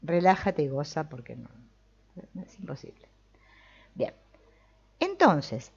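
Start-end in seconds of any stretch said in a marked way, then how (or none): none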